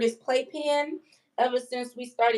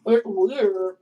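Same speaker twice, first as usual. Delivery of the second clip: while brushing teeth; 3 semitones lower, 4 dB louder than usual. Real, not acted. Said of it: "I'm brushing my teeth".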